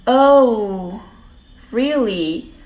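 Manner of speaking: ordinary talk, nothing marked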